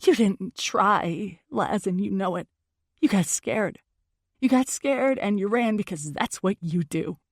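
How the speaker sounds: plain reading voice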